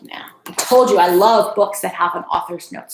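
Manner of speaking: plain reading voice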